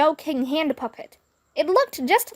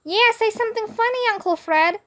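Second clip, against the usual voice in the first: put-on high, fast kid voice; higher bratty kid; kid heckling; 9 semitones higher than usual. A narrator's reading. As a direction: demand it like an impatient kid. Kids heckling.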